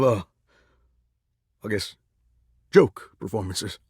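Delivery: low, halting disbelief; crestfallen, hesitant. Acted. Singer realising the show made them a joke, crestfallen. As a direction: falter through it, pitched low with long pauses.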